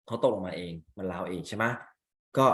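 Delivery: ordinary talk, nothing marked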